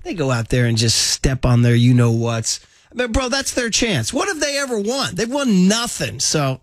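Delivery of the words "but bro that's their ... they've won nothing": exasperated lashing out. Real, not acted. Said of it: "I'm angry at those guys right now".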